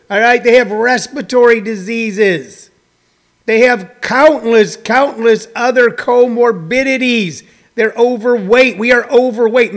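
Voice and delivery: ordinary talk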